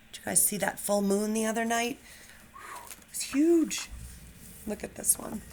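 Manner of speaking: lively picture-book read-aloud; animated storybook narration; reading to children. A narrator's reading. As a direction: read it like storytime for first graders. Teacher reads picture book aloud.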